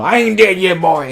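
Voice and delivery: high, loud movie-monster outburst; hammed-up scary-movie comeback; mock undead monster. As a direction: pop back up loud and high, hamming it up. Voices goblin playing dead springing up.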